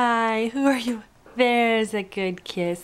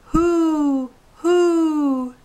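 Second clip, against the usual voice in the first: high, loud hooting owl; 6 semitones higher, 5 dB louder than usual. Shown as a demonstration. Mom showing baby the owl sound, high hooting. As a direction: hoot it out like an owl. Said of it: "What sound does an owl make?".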